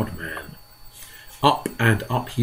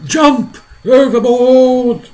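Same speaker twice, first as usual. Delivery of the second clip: high, loud, slow frantic cry; panicked shout; 14 semitones higher, 11 dB louder, much slower than usual. A narrator's reading. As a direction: bellow the order in fright.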